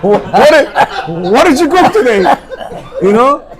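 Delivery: high, loud laughing mimicry; mock her questions while laughing; laughing mockery.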